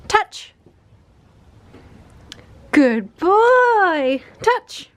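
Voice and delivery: high puppy training praise. Real, not acted. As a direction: reward the puppy with gushing praise.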